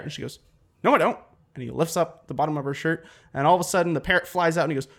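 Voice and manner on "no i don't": high, louder mock denial; hammed-up quick denial; acted denial. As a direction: protest innocence, pitched up. Passenger denies it in joke.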